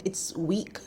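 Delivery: ordinary delivery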